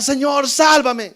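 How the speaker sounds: loud, high cry to the Lord; acted cry for help. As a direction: call out slowly for rescue, preacher acting it. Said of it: "empezó a gritar".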